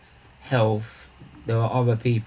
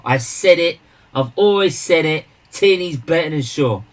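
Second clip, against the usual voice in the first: loud, raised-pitch declaration; real heated insistence, punched out; emphatic insistence; 5 semitones higher, 7 dB louder than usual. Real, not acted. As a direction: argue the point forcefully to a football rival. Insists on his football opinion.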